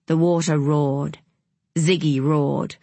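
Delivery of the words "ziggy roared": plain reading voice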